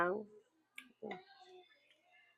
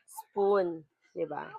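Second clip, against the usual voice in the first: ordinary delivery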